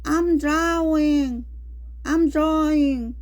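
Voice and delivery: high, drawn-out cry for help; emotional exclamation. Shown as a demonstration. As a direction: call out slowly as if drowning. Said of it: "expressing one emotion or a strong feeling".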